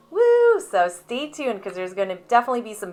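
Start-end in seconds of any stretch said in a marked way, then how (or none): 0.1-0.6 high, loud drawn-out "woo"; excited cheer. Real, not acted. Cheering the baby's babbling.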